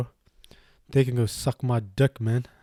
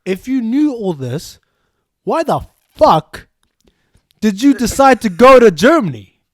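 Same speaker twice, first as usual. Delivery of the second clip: incredulous anger; 9 semitones higher, 15 dB louder than usual. Retold or imitated. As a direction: demand it, furious she went anyway. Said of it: "And I said to her".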